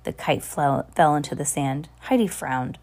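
plain reading voice

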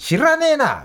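dismissive sneer. Retold or imitated. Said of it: "みたいな".

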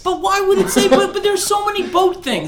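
high, loud, laughing protest over the boat slang; exasperated disbelief. Real, not acted. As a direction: throw up your hands at the word boat, laughing.

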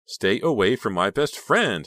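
high, loud, slow furious order; ugly fury. A narrator's reading. Honey furiously ordering Bill away from Becca. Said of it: "twisted in ugly fury".

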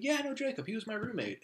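high-pitched imitation of a friend; retold excited recognition. Friend recognizes shared roommate, high excited retelling.

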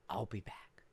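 soft, breathless I'll be back; whispered exit. Retold or imitated. Cashier whispering to the manager as she leaves. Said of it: "And she goes".

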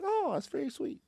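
high, soft, sweet reply; polite sweetness. Retold or imitated. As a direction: coo it politely, acting out the reply. Retelling his polite reply to the lady.

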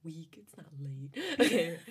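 whispered scornful aside. Real, not acted. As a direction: breathe it out as a mocking aside. Mocking aside while reading.

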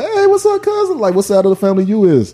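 hammed-up fake cousin voice; fake-friendly cousin. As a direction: mimic a long-lost cousin sucking up. Imitating cousins greeting him.

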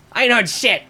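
high, loud cop sneer; Jenkins' voice. Acted. Jenkins answering his partner, high and loud. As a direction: toss it off like a lazy beat cop. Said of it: "And Jenkins goes".

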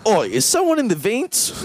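high mock Australian accent; lightly put-on Australian guard calling out. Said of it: "They're gonna be like".

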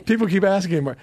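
normal talking voice

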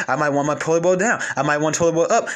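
ordinary delivery

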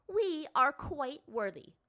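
high, loud, drawn-out assertion; haughty. A narrator's reading. Azula pressing the master, haughty. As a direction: insist slowly on your worth. Princess arguing her case.

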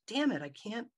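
exasperated that she won't move; frustrated. Retold or imitated. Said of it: "And it was so frustrating".

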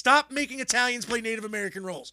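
half-joking frustrated shout; exasperated rant. Retold or imitated. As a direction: throw up your hands at the casting. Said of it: "I'm like".

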